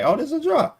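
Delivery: squeaky high, overconfident; mock his own naive confidence up high.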